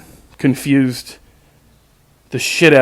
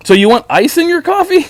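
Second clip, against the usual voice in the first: much higher, mocking the kid's baffled question; mock dumb question; 14 semitones higher, 3 dB louder than usual. Mimicking the confused drive-thru worker, high and incredulous. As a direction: squeak it out like a clueless kid.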